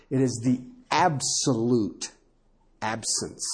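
"absence" dropped low and soft; stress on absence. Shown as a demonstration. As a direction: land hard on "absence". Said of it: "make sure you get".

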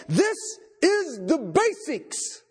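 slow, pointed emphasis; emphatic. Acted. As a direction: hammer each word home face to face. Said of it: "grab you by the ears, look you right in the face and say".

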